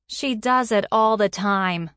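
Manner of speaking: teasing coworker calling out, drawn out; chiming in. A narrator's reading. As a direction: chime in over a partition. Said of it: "a female voice chimed in".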